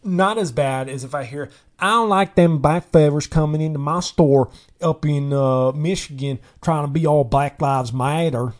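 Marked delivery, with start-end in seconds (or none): 1.8-8.5 loud mock Southern accent from a Michigan suburb. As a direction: caricature a Northerner talking in a Southern twang.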